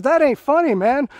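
high, loud retold yell from the sergeant; shouting down at the laughing private; angry shout. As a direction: bark it like a furious sergeant. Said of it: "He says".

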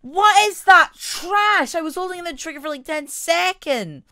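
high, loud disbelief; exasperated high-pitched rant; outraged yelling. Real, not acted. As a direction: shriek about the useless weapon. Raging at a weak gun.

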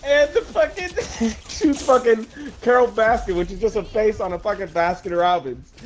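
high-pitched, helpless laughter through the words; can barely get the words out for laughing; laughing hard. Real, not acted. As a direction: laugh your way through the description. Cracking up describing the scene.

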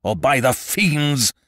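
high, loud, drawn-out oath; shouted threat. A narrator's reading. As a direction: threaten like a raging tyrant. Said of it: "shouted the monster".

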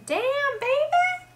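put-on squeaky high-pitched "Damn, baby."; catchphrase in a high voice. Shown as a demonstration. Answering the tag question with her catchphrase, squealed up high. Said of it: "would probably have to be".

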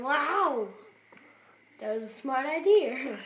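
loud, high amazed exclaim; amazed wow. Real, not acted. Gamer amazed at his own smart move, loud. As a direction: shout it out, thrilled.

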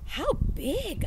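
hushed whispered question, high and breathless. Acted. Girl asks the librarian about the bear, in a whisper. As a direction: whisper it, wide-eyed at the bear story.

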